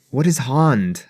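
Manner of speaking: "hand" echoed as "hahnd"; mimicked broad A. Host questioning actor's pronunciation, "hand" as "hahnd". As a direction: query "hand" with a long "ah".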